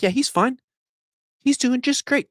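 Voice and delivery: high mocking sarcasm, pitch way up; sarcastic. Real, not acted. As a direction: pretend to praise him, dripping with irony. Sarcastic praise of a slumping player.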